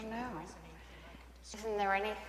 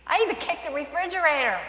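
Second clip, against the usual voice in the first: higher and louder, fed-up admission; exasperated confession; 7 semitones higher, 6 dB louder than usual. Acted. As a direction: own up to kicking it, worked up. Skit, wife admits her frustration.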